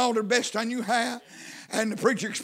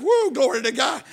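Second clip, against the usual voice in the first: reenacted cry of joy to God; raised, emphatic testimony voice; exultant praise; 3 semitones higher, 4 dB louder than usual. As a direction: proclaim it jubilantly, reliving the moment. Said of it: "And I said".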